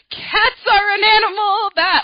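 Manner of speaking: high, loud, slow showy reading; so animated. Retold or imitated. Teacher demonstrating the report to class, high, loud and animated. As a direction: read it like a performer to the class. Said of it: "like, so animated".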